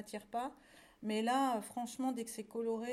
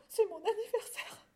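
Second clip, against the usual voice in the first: very high, acted tearful voice; sobbing little lamb; 10 semitones higher than usual. A narrator's reading. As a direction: sob it out as a small lamb. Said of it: "sanglota le petit agneau". (petit agneau qui sanglote)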